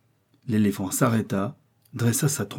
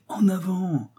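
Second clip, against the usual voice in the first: high, drawn-out urging call; joyous cry; 10 semitones higher, slower than usual. A narrator's reading. Joyful Joey urges the elephant onward, joyous high cry. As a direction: cheer the beast forward at a high pitch. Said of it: "s'écria le joyeux Joey".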